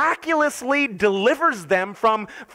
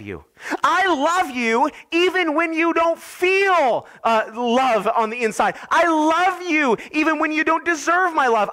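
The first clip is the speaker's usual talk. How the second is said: God speaking to his people; 5 semitones higher than usual. Acted. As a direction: lift the voice as God pledging love. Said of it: "this is God saying to his people".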